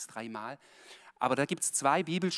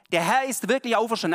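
louder, higher and fast; excited proclamation; 7 semitones higher, 8 dB louder, faster than usual. Acted. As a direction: cry it out in joyful news. Disciples exclaiming.

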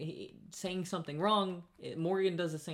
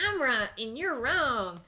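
higher, louder, slow mock voice, smug; entitled customer; 7 semitones higher, 5 dB louder, slower than usual. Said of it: "She's like".

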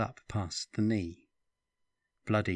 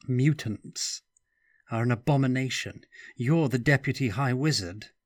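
raised, urgent accusation; 4 semitones higher, 5 dB louder than usual. Acted. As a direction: press the point hard.

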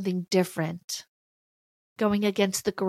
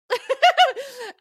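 high bright laugh; 7 dB louder than usual. Real, not acted. Laughs at own outburst.

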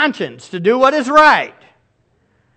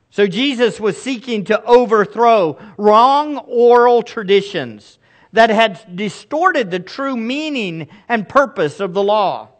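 ordinary delivery

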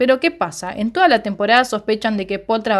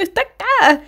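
frightened warning; 9 semitones higher than usual. Retold or imitated. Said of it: "como diciendo".

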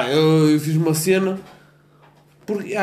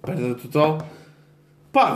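ordinary talk, nothing marked

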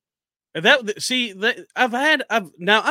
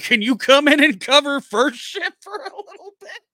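pushy boss; 10 semitones higher, 5 dB louder than usual. Retold or imitated. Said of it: "And my text back was".

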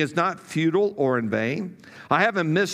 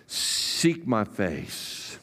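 very slow, soft and low invitation; hushed call of God; 8 semitones lower, 5 dB quieter, much slower than usual. Acted. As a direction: let it linger as a whispered summons. God's call spoken in sermon.

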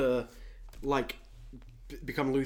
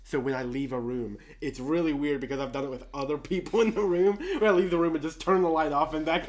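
normal talking voice

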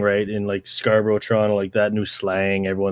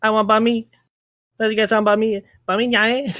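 put-on high, chirpy kid voice throughout; mock kids' voice; 11 semitones higher than usual. Mimicking school kids asking each other for banh mi, high-pitched. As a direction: play up the kids chattering about food. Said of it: "a bunch of Vietnamese kids".

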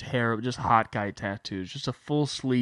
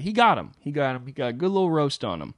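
jumps up high and loud on got; an excited burst at the roast; 5 semitones higher, 5 dB louder than usual. Retold or imitated. Retelling the friend's roast, loud and excited.

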